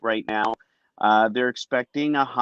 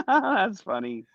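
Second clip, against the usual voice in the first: laughing; 8 semitones higher, slower than usual. Real, not acted. Laughing at a weather clip.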